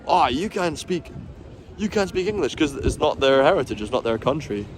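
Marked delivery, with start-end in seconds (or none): 0.1-2.6 higher, softer put-on complaint; light mimicry of a blaming tourist; mock indignation. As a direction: feign being put out, pitched up. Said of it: "blame them or get angry for".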